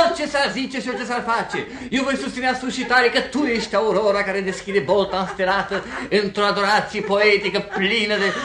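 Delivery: pompous lover's speech; grandiloquent declaiming. Acted. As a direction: proclaim it like a poet on a podium. Suitor declaims high-flown love speech.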